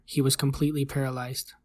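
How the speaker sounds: plain reading voice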